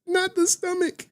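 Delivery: high, delighted shriek; high squeal. Real, not acted. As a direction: squeal it in glee. Squealing at the clip line.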